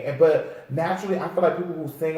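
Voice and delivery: usual speaking voice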